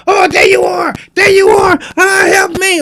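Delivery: shrieked falsetto cries for help; hammed-up horror-movie victim; comic screaming. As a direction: scream it out, very high and loud. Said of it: "She's screaming and calling".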